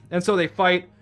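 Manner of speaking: ordinary talk, nothing marked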